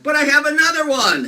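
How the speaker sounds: high, loud showman call; loud cheerful announcement. Acted. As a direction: announce it to the crowd. Magician answers audience, loud.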